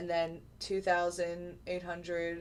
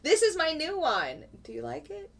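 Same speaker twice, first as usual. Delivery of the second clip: playful showing off; 9 semitones higher, 4 dB louder than usual. Real, not acted. Showing off new monitor playfully.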